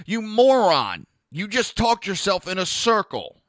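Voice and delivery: loud, high, scornful rant; angry outburst. Real, not acted. Berating the gun-ban talker, loud and angry. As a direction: tear into him, furious. Ranting at a politician.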